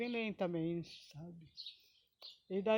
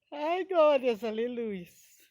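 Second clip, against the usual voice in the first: normal talking voice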